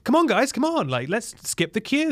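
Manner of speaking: high, urging dad voice; rally the family to cut in; pushy dad. Retold or imitated. Dad urging his family to skip the queue, high and pushy.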